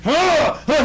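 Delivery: a sudden high startled yelp; startled cry. Acted. The startled king crying out at the furnace. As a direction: jump with fright.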